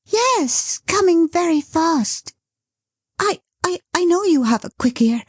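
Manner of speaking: high, rushed and breathless; flustered. Acted. Dot hiding her excitement from Bertha, fast and flustered. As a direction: talk fast to hide the pounding heart. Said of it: "placing her hand upon her heart".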